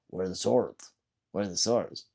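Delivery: low, soft mimicked question; subdued retold question. Streamer retells the troll's question, quiet and low. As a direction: quote the troll under your breath.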